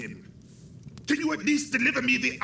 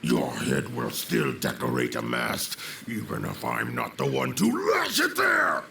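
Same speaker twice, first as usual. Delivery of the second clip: a low, menacing threat that builds to a shout; defiant threat; 11 semitones lower than usual. Acted. Villain shouting defiance at the hero. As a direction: spit the promise back at him, rising to a yell.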